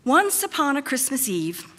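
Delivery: ordinary talk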